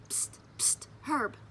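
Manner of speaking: unvoiced secret whisper. A narrator's reading. Junie B whispering to Herb in class, secret. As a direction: hiss it so the teacher won't hear. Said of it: "I whispered".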